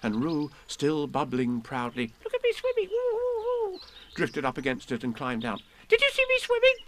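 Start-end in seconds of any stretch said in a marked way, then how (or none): 2.2-3.8 very high, tiny excited kangaroo voice; little Roo voice. Acted. Little Roo swimming, bubbling proudly. 5.9-6.8 very high squeaky joey voice; excited squeak. Acted. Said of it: "squeaked Roo excitedly".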